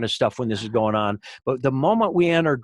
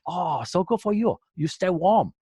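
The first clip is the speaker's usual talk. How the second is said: Doctor Quay voice; 5 semitones higher than usual. Retold or imitated.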